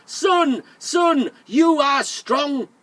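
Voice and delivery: high, loud call to the sun; big storybook shout. Acted. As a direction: shout it skyward, drawing out the last words. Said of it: "he shouted".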